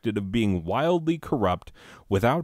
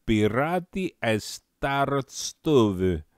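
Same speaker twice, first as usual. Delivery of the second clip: put-on slow, careful stab at a Czech accent; a guessing, overdone Czech accent; mock Czech accent; 2 semitones higher, slower than usual. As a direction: say the Czech name like a Czech, unsure. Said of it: "I don't know what a Czech accent sounds like".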